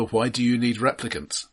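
ordinary delivery

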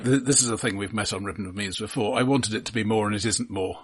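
ordinary talk

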